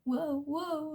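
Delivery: high mock whoa as if losing balance; imitating toddler wobbling. Mom mimicking her little son's wobbly balance act, high playful whoa. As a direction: act out a toddler pretending to tip over. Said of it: "he starts doing this".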